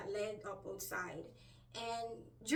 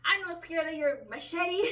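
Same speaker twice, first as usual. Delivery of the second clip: loud, higher-pitched retold challenge; defiant; 6 semitones higher, 8 dB louder than usual. Dreamer facing a man with a machete, retold defiant shout. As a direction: call it out in mock defiance.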